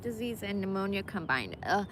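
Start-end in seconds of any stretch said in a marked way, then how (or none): none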